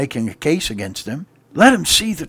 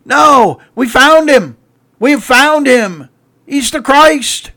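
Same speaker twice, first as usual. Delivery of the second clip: high, loud, slow joyful proclaiming; jubilant announcement; 10 semitones higher, 11 dB louder, slower than usual. Acted. Andrew telling his brother, loud and jubilant. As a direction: ring it out like wonderful news.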